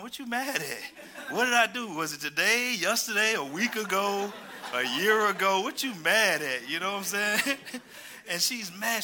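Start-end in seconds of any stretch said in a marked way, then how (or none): 0.0-6.7 mock baffled protest. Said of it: "I said".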